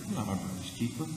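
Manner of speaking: low, soft seething mutter; quiet resentful Cain. Retold or imitated. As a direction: hold back anger under the breath. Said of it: "seething tone in it".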